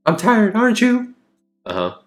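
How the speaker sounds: high, sugary consoling woman's voice; sweet clingy girlfriend. Acted. Anna soothing hurt Jack, high syrupy coo. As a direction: coo at him like she owns him.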